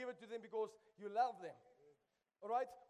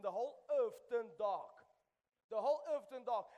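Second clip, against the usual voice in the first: usual speaking voice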